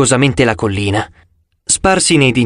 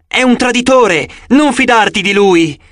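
warning shout; 8 semitones higher than usual. A narrator's reading. Dreaming narrator shouting a warning to Annabeth. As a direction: shout a warning to stop her. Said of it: "Io cercai di gridare".

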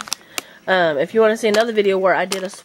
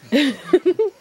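short high giggle; high laugh; 9 semitones higher than usual. Real, not acted. Laughing at Pug stealing the nougat, a high giggle. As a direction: giggle at the dog.

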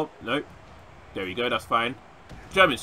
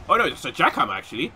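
ordinary delivery

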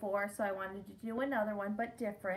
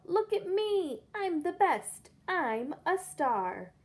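high, loud bragging pug; show-off shout; 8 semitones higher, 5 dB louder, slower than usual. Acted. Pug Pig shouts at everyone to look at him, loud bragging. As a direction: crow it like a spoiled star. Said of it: "He'd shout".

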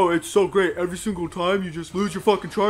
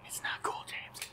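breathless whisper, much softer; angry whisper; 16 dB quieter than usual. Real, not acted. Whispering at James that the game isn't cool. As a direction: hiss it through frustration at the game.

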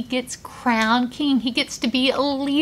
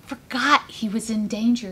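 usual speaking voice

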